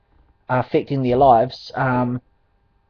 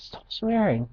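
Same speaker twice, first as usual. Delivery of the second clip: a light imitation of a teen girl, higher and hushed; girl telling them off; 7 semitones higher, 7 dB quieter, slower than usual. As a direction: plead with them softly in her higher voice. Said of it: "She goes".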